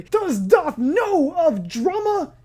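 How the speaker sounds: slow, overblown movie trailer voice; dramatic narrator voice. Pretend. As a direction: intone it like a doom-laden epic narrator. Jokey epic narration about himself.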